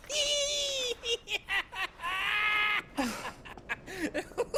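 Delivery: roaring happy laugh; hearty laugh. Acted. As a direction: laugh big and free. Hearty laugh during a crushing hug.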